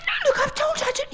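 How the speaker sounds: quick high refusal; girl's voice. Acted. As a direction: hurry the girl's protest up high. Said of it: "she said".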